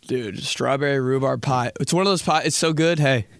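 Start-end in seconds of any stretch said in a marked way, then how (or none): none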